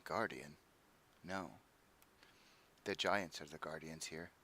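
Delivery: low, soft and sorrowful; soft giant voice. Acted. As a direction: speak it softly, heavy with loss. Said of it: "I'm sorry, I didn't hear that".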